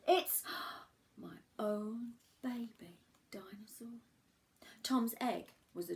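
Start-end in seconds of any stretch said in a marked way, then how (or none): 0.1-4.0 breathless hushed delight at the hatchling; whispering in amazement; awed whisper. Acted. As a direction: gasp then whisper the words in wonder. Tom sees baby dinosaur.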